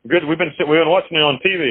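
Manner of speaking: high, lightly imitated Irish London voice; Irish London accent. British gangster welcomes the new prisoner, lightly imitated Irish London accent. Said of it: "Irish London accent".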